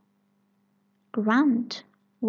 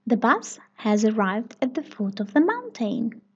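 ordinary delivery, nothing marked

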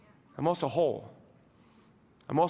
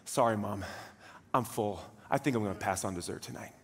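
sheepish son; 11 semitones lower, 8 dB quieter than usual. Retold or imitated. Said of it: "the son goes".

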